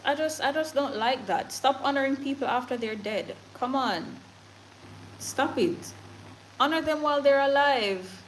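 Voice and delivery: ordinary talk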